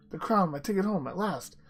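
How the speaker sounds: high, loud storybook exclamation; elated. A narrator's reading. Lionel reaching for the crown, elated. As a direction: exclaim it in triumphant relief.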